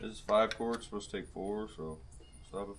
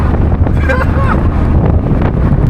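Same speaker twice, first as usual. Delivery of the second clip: breathy excited laugh; 5 dB louder than usual. Real, not acted. Excited after fast drive.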